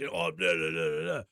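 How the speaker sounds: low, dopey mimicking babble; mocking blather. Driver mimicking the guard's excuses, mocking low blather. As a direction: drop deep and blather like a droning guard.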